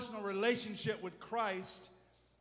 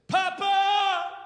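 crying out; 12 semitones higher, 12 dB louder than usual. Retold or imitated. Jesus crying to his father.

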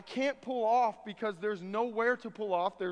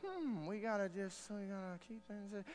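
soft, half-swallowed mock grumble; muttered objection; 10 dB quieter than usual.

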